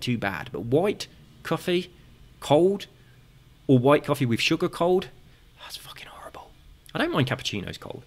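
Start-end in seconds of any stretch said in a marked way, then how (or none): 5.6-8.0 hushed whisper, out of earshot; whispering while hiding. Real, not acted. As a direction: whisper so nobody downstairs hears. Cleaner downstairs, speaking quietly.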